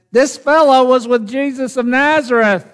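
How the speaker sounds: high-pitched voice pointing someone out; accusing servant girl. Acted. Another servant girl at the gate about Peter, accusing.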